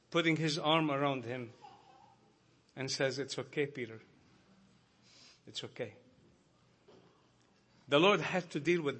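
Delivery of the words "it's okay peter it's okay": soft and low, fading almost to nothing; gentle reassurance. Retold or imitated. The Lord comforting a weeping Peter, soft and tender. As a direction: comfort him softly, like an arm round his shoulder. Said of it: "the gentle Savior".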